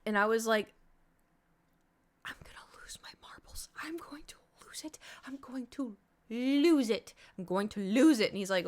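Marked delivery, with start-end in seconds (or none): 2.2-5.0 breathless unvoiced whisper, on the edge; frustrated editor whispering to her friend Nate. Retold meltdown while editing. 5.3-8.3 from a slow moan up to a loud cry; frustrated editor cracking while on the phone with Nate; building to a yell. Retold or imitated. Retold meltdown building.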